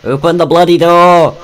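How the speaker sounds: loud, high hammed-up British shout; mock British yell. Shouting at his friend to open the door in mock British. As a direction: bellow it like an angry Brit at the door.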